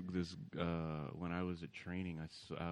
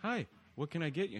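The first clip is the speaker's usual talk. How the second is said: higher imitation of a counter girl; accentless girl at counter; 8 semitones higher, 4 dB louder than usual. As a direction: mimic a friendly server with no accent. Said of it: "She doesn't have an accent".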